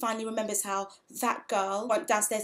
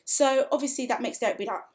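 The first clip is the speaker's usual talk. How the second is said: usual speaking voice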